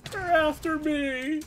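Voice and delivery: high panicked yelp; panicked cry. Real, not acted. Gamer chased by enemies, panicked high yelp. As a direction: shriek it as they close in.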